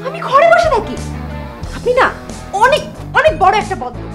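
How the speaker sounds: high-pitched, indignant outburst; offended, heated accusation; outraged retort. Acted. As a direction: take offence and hit back. Insults the neighbour in quarrel.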